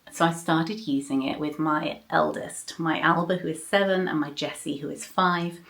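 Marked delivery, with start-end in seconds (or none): none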